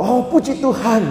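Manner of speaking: grateful grandma. Acted. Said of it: "oma-oma suka gitu kan".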